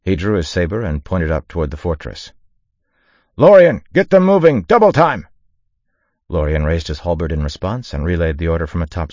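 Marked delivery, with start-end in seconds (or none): 3.4-5.3 very loud, high-pitched officer's yell; shouted command. A narrator's reading. Konoha orders Lorien to move the regiment, shouting. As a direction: holler urgent orders over the battle.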